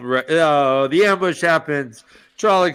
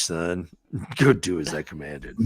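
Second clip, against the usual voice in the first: much lower, softer, grave command voice; stern general; 11 semitones lower, 7 dB quieter than usual. Acted. The general ordering his captain off, low and grave. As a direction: dismiss him like a stern old general.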